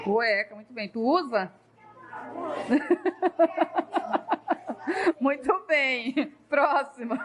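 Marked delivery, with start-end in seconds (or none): 2.1-5.1 high burst of laughter. Real, not acted. Teacher laughs at joke. 5.2-7.2 laughing. Real, not acted.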